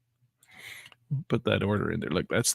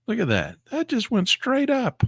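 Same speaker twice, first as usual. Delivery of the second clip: much higher, slowed in disbelief; astonished; 13 semitones higher than usual. Real, not acted. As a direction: let it rise in amazement. Price chart shoots up.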